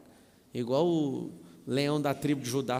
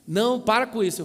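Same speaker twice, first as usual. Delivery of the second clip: higher, louder imitated kid; protesting child; 6 semitones higher, 8 dB louder than usual. Child refusing, acted. (criança protestando, aguda e alta)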